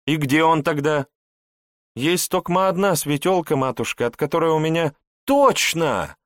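a hint of anxious princess.

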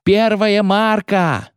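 very high, long-held call; sailor's bellowed call. A narrator's reading. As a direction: sing the sounding out, stretched. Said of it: "заорал матрос с лотом". (очень протяжный высокий выкрик)

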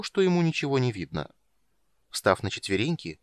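ordinary delivery, nothing marked

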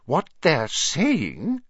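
slow, high, insistent; pressing on. A narrator's reading. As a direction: press on deliberately with grave news. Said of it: "she pressed on".

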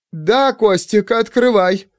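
a frightened aunt calling to her nephew through the door; trembling voice. A narrator's reading. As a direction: answer through nervous trembling. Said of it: "дрожащим голосом ответила тётя Таня".

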